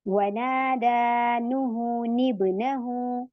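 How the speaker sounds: slow chanted recitation with a falling close; Quranic recitation. Shown as a demonstration. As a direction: draw the words out as in tajweed. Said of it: "इसी तरीके से यहाँ पे देखिए".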